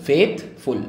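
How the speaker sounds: Faithful split in two, फेथ, then फुल; syllable by syllable. Shown as a demonstration. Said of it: "दो बार हो रहा है ना". (Faithful को दो हिस्सों में तोड़कर)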